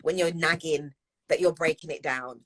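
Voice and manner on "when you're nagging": usual speaking voice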